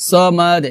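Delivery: "সামাদ" as sawmaad-e, dark s, bounced d; heavy s, qalqalah. Shown as a demonstration. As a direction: darken the s of "সামাদ" and let the d echo. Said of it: "এভাবে".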